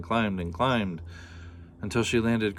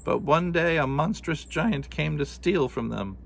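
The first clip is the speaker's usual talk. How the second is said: lightly voiced old woman, somewhat higher; a storyteller old woman, pitched up; old woman telling a tale; 7 semitones higher than usual.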